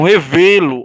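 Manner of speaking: "revelo" loud, the VE strongest; stress on VE. Shown as a demonstration. Teacher proving to students the tonic syllable, VE hit hard in "revelo". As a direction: make VE stand out in "revelo". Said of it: "o V sai mais forte".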